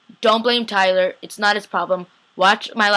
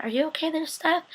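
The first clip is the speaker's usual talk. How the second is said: high-pitched mock concern; acted wary concern; 8 semitones higher, 6 dB quieter than usual. Asking Steph about his weird eye thing, mock concerned. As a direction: check on him in a raised, tentative voice.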